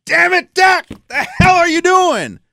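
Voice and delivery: high loud shout at the TV; furious at the quarterback; retold couch rage; angry yelling. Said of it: "I'm always yelling".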